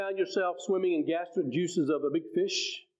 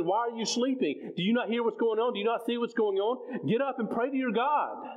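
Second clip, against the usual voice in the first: higher-pitched, insistent acted questions and order; urgent mock scolding; 5 semitones higher than usual. Sailors waking Jonah during the storm, urgent scolding. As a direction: act out alarmed sailors rousing a sleeper.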